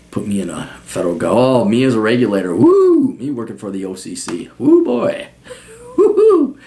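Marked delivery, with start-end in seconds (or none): 2.5-3.1 high-pitched playful woo; hooting mock celebration; excited whoop. Whooping at imagined regulator job. 4.6-6.6 high, giddy mock hollering; gleeful high-pitched hoots; playful whooping. Whooping at imagined regulator job.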